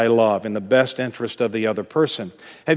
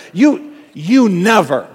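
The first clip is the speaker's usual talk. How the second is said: louder, higher, slow accusation with stressed You; accusing snap; 6 semitones higher, 6 dB louder, slower than usual. Acted. Spouse in a conflict, hurling a blaming accusation. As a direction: fling the blame at your spouse. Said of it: "you say something like this".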